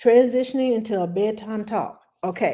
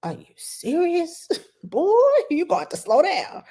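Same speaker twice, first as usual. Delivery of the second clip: surprised; 10 semitones higher than usual. Retold or imitated. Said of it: "I was like".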